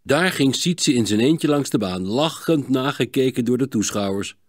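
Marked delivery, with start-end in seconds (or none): none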